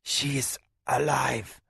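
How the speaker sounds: weak, slow croaking voice; croaked. A narrator's reading. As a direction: gasp it out, dying. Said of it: "croaked a voice".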